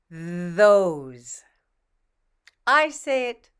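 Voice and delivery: normal talking voice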